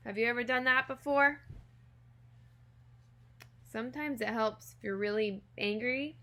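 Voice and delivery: ordinary delivery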